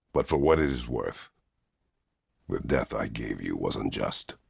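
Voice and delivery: ordinary talk, nothing marked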